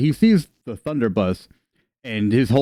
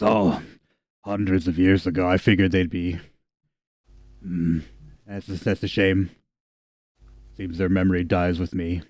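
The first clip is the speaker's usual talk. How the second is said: put-on a touch lower, pausing over old memories; mildly mournful ancient figure; lightly voiced old giant, wistful; 3 semitones lower, 4 dB quieter than usual. GM voices elder character.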